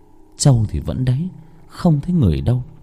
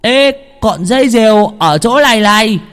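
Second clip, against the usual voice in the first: put-on higher, louder lisping boy's shout; lisping stammer; 12 semitones higher, 6 dB louder than usual. Hữu shouts to the searchers he found the kite string, lisping boy's shout. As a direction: blurt it out with a childish lisp. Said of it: "giọng ngọng líu ngọng lô".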